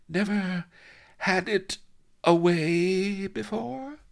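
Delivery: very high, slow, halting question; high-pitched, wide-eyed astonishment; incredulous. A narrator's reading. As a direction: draw it out high in amazed disbelief. Astonished mistress finishes her halting question.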